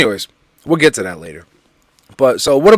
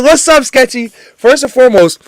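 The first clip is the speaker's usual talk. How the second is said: excited shout-out; 10 semitones higher, 5 dB louder than usual. Real, not acted.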